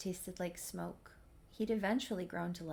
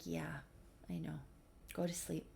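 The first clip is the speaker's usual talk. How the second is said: soft, hushed bedtime reply; tender and much softer; gentle soothing; 3 semitones lower, 11 dB quieter than usual. Retold or imitated. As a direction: quietly settle someone drunk down to sleep. Acted soothing a drunk to sleep.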